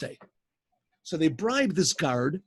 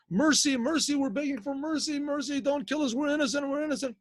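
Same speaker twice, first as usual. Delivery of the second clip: desperate begging for mercy; 8 semitones higher than usual. Retold or imitated. Brothers crawling out of the bushes, pleading with the minister for mercy, high and desperate. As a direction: beg for your life from the ground.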